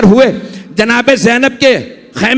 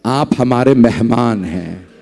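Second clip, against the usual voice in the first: smiling gentle reply; 8 semitones lower than usual. A narrator's reading. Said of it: "मौला ने मुस्कुरा के कहा".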